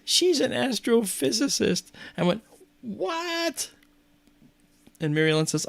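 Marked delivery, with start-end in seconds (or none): 0.1-1.9 high, slow, put-on voice; laughing nun's revelation. Nun revealing her friend's profession, high slow voice. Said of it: "points at Mary Ellen and says". 2.8-3.7 very high, drawn-out disbelief; astonished. Retold or imitated. Reacting to the nun's revelation, high astonished cry.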